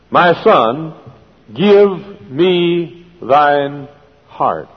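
very slow, each word drawn out; stretched, weighty verse recitation; solemn scripture quote. Acted. Solemn quoting of the arresting verse.

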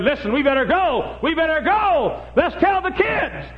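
high, loud cry of warning; urgent shout. Acted. Husband warning his wife to flee, urgent shout.

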